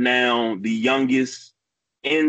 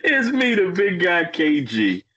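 ringmaster; 4 semitones higher than usual. Acted. Host introduces himself.

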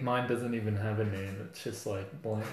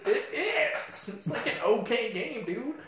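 squeaky higher backpedal; high-pitched; 9 semitones higher than usual. Real, not acted.

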